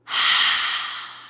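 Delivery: long, airy exhaled sigh; deep sigh. Shown as a demonstration. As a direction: let all the breath out in a sigh. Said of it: "let it all out with a deep sigh".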